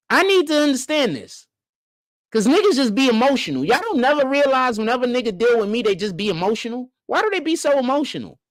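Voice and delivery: high-pitched, incredulous complaint; baffled exasperation. Real, not acted. Baffled rant about emotional men.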